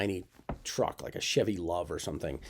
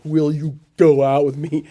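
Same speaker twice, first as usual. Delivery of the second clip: dumb first grader; 6 semitones higher, 12 dB louder than usual. Retold or imitated. Reading his childish note.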